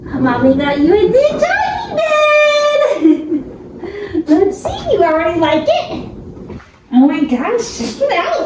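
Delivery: excited pet talk. Real, not acted. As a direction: squeal with joy at the dog. Showing dog new bed.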